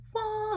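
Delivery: high drawn-out "wah", falling off; imitated transformation noise. Reader showing creatures turning into light beings, high imitated noise. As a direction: sing out a high sound-effect "wah".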